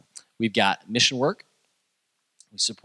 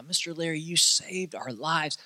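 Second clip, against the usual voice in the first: slow tender gratitude; 7 semitones higher than usual. Retold or imitated. Grateful woman's words repeated.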